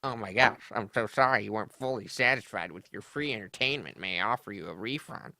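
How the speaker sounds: fast, sneering sassy mimicry; sneering imitation, fast and dismissive; sassy mockery. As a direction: mimic his snotty apology. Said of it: "Listen to that sass".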